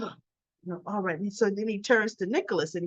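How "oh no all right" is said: much softer, resigned giving in; meek backing down. Retold or imitated. Spencer giving in to his uncle, soft and meek. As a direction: fold quietly under pressure.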